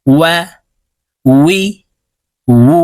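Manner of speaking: ওয়া, উই, উ with lips rounded, hollow in the middle; measured demo of Arabic waw. Shown as a demonstration. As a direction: start ওয়া, উই, উ on a rounded w glide. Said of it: "ঠোঁট গোল করে উচ্চারণ করতে হবে".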